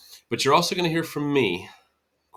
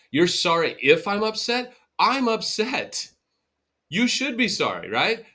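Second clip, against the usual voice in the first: much higher, louder, incredulous pushback; indignant retort; 9 semitones higher, 5 dB louder than usual. Retold or imitated. Hurt partner firing back at a non-apology, indignant and loud. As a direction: throw the words back in disbelief.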